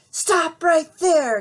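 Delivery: Granny bursts in shouting; yelled. Acted. Granny yelling at the wolf to stop. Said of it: "yelled Granny".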